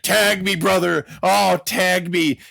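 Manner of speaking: hammy pleading Hulk; Hulk begging. Retold or imitated. Hulk on the apron begs Savage for the tag. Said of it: "He's like".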